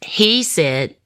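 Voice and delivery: ordinary talk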